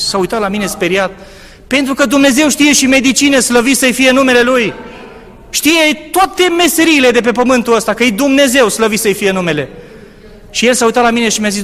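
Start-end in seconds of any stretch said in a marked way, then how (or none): 1.7-9.7 higher and louder exaltation; rising fervent sermon voice; fervent preaching. Real, not acted. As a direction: lift the church up with praise.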